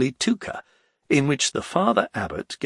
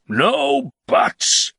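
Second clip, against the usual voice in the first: raised, drawn-out baron voice; a tipsy nobleman begging to ride away; the Baron, pleading; 4 dB louder than usual. Acted. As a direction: urge your friend to leave, loud and slow. Baron cuts off.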